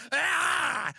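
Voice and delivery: loud high-pitched scream; man screaming at the counselors; high yell. Retold or imitated. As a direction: shriek out in rage. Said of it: "And the guy's like".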